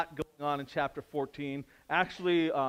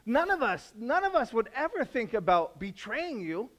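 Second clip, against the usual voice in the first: much higher, louder panicked questions; panicked disciples; 11 semitones higher, 7 dB louder than usual. Retold or imitated. Disciples panic at the supper, high and frantic. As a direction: sputter in mock panic. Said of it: "they're panicked".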